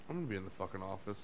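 soft, fast, fed-up grumble; curt quiet grumble. Retold or imitated. Soaked chef grumbling quietly as she leaves. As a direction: mutter it and storm off. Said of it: "She's like".

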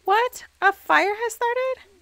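high, slowed pleas to put the fire out; frantic player yelling at her sims; panicked urging. Real, not acted. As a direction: beg them to put out the fire.

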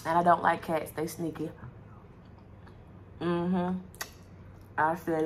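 fingers in mouth; licking sauce off fingers; mouth full, chewing. Real, not acted. Seen 0: chewing wing earlier, fingers in mouth during the words.

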